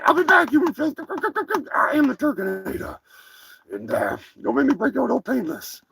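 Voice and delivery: a cocky cartoon turkey boasting; turkey character.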